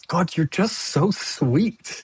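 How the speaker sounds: imitated adoring coo; gushing affection. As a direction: draw it out as a fond compliment. Retells his gushing reply to star.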